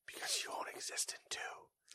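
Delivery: whispered like a scheming villain; sly whisper. Acted. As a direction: whisper the secret plan gleefully. Voicing Tencent's secret scheme.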